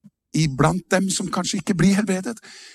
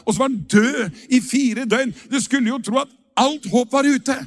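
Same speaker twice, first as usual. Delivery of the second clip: raised, driving sermon voice; rousing preaching; 6 semitones higher than usual. Acted. Pastor to the congregation, rousing preaching. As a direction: preach it with mounting fire. Rising preaching about Lazarus.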